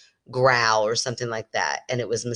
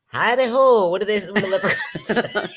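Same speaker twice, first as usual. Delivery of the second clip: high, squeaky, hammed-up Irish leprechaun voice; bad Irish leprechaun accent; 7 semitones higher, 4 dB louder than usual. Joking about a leprechaun in the fireplace, hammed-up high Irish accent. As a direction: play a silly leprechaun badly in Irish. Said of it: "we know I can't do that accent".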